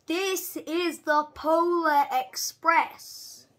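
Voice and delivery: slow, loud, grand conductor voice; grand announcement. Acted. Guard presenting the train to the boy, grand and slow. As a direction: proclaim the train's name.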